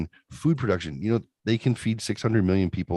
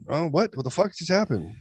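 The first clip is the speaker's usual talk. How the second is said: mock shock; 10 semitones higher than usual. Joking mock reaction to caricature.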